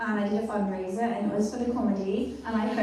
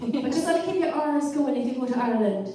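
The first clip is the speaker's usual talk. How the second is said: higher, lilting Irish accent that keeps its R's; a playful Irish lilt, warned off drifting toward Scottish; 4 semitones higher than usual. Shown as a demonstration. A comedian trying the Irish accent on request, sounding lightly Irish. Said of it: "Can you do, uh, Irish?".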